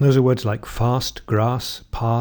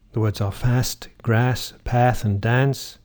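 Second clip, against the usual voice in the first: General American: "fast", "grass", "path", "dance" with the flat trap vowel; American accent; slower than usual. Shown as a demonstration. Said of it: "In America".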